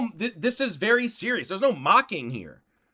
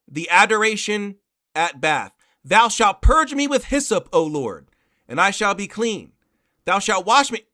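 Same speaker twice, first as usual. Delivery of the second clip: louder, solemn prayer reading; 6 dB louder than usual. Acted. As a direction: proclaim it like scripture. Reads prayer text aloud.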